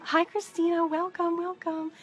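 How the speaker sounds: real cheery hostess greeting; excited greeting. Real, not acted. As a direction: welcome them in eagerly. Greeting a viewer who just joined.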